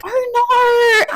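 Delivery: high, wailing Australian "oh naur"; mock Australian accent. American imitating her friend to Ella, hammed-up Australian accent. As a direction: stretch the vowels in parody Australian. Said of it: "it's exactly what you sound like".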